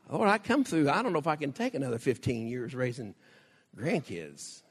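ordinary talk

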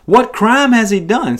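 high, insistent question; demanding. A narrator's reading. Pilate demanding the crowd explain, high and insistent. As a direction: press the demand, pitched well up. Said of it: "Pilate demanded".

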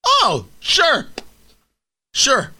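high-pitched, disbelieving "sure"; sarcastic agreement. Real, not acted. Host mocking the CEO's reassurance, sarcastic.

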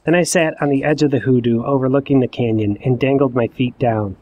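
ordinary delivery

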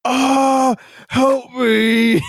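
high, loud, hammed-up cry for help; imitated victim's scream, drawn high and loud; mock scream for help. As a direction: wail like a horror-movie victim. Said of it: "screaming someone to help him".

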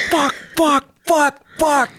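high, hammed-up angry cursing while kicking; mock cursing. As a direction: curse at the stumps, playfully furious. Kicking over stumps in anger.

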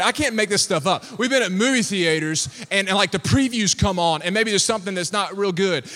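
usual speaking voice

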